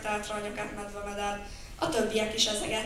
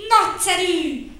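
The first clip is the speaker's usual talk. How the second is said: higher, loud and slow with joy; delighted; 9 semitones higher, 13 dB louder, slower than usual. Acted. Child thrilled to be arrested, delighted cry. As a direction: cheer it gleefully. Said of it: "örült Vacska Mati".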